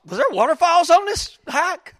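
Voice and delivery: imitated high-pitched puzzlement; baffled question. Hiker who missed the waterfalls, high baffled question. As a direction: pitch it way up in mock bewilderment. Retold clueless hiker asks back.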